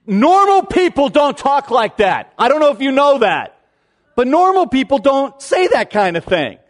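exasperated and baffled, played for laughs; mock incredulity. Mock outrage at churchy phrase.

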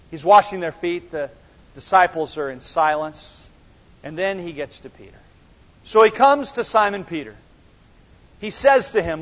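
normal talking voice

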